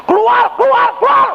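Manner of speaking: rapid-fire very high shouted commands; frantic exorcism shouts. Retold or imitated. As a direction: hammer the order at the demon. Retold repeated exorcism shouting. (teriakan mengusir bertubi-tubi)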